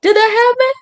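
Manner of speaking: high, incredulous questioning; doubting audience. Retold or imitated. Audience wonders whether the joke is real, high-pitched doubt. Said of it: "people have to go".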